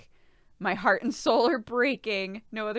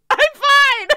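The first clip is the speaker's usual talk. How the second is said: much higher and louder, sobbing out the words; crying; 17 dB louder than usual. Retold or imitated. Retelling telling the doctor she's fine while crying, sobbing. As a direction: force out fine through tears. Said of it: "Just, like, crying".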